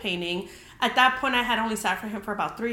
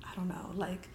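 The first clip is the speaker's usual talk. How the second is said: soft breathy aside; whisper; 3 semitones lower, 11 dB quieter than usual. Real, not acted. Telling viewers about the crowd arriving, a soft whisper. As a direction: drop to a whisper mid-thought.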